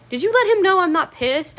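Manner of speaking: high, loud, stunned question; stunned disbelief. Retold or imitated. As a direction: blurt it out in shock, voice jumping up. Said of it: "I was just stunned".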